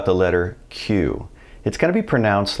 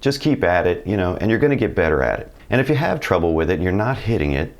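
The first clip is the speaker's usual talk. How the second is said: ordinary talk, nothing marked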